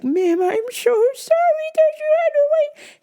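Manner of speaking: high, sweet apologetic coworker; overly sweet clerk apologizing to a rude customer; sugary coworker voice. Retold or imitated. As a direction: put on a syrupy customer-service voice.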